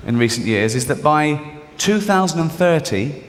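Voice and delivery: ordinary talk, nothing marked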